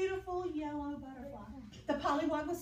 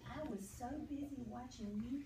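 soft pollywog voice; 6 dB quieter than usual. Acted. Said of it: "he said".

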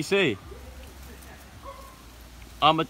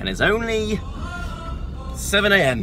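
shouting over music; 10 semitones higher than usual. Real, not acted. Complaining over loud car music.

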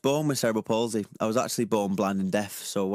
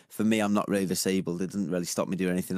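usual speaking voice

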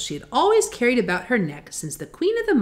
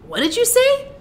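puzzled scarecrow; 4 dB louder than usual. Acted. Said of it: "asked the Scarecrow".

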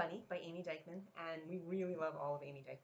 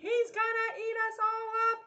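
high, loud playful scream; 10 dB louder than usual. A narrator's reading. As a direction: scream it like scared little bunnies. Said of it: "they screamed".

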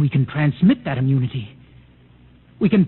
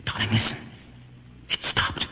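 softer, hushed warning, dropping to a whisper; 5 semitones lower, 9 dB quieter than usual. Acted. Husband hiding from the robot, hushed whisper. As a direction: keep it low so it won't hear.

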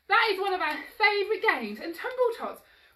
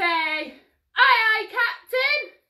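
high, loud reply to the captain; crew call; 4 semitones higher, 6 dB louder, much slower than usual. Pretend. Instructor leading children as pirate crew, high loud call. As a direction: call it out like a deckhand.

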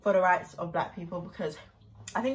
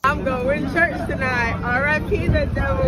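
hyped-up proclamation; 5 semitones higher, 7 dB louder than usual. Real, not acted. Loud hyped outburst quoting the song.

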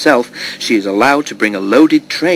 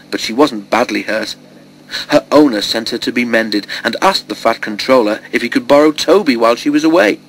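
usual speaking voice

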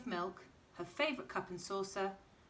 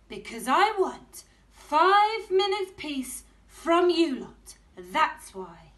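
high, loud, slow exasperated telling-off; loud fed-up mum; 9 semitones higher, 15 dB louder, slower than usual. Acted. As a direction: snap it out fed up. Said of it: "said Missus Large".

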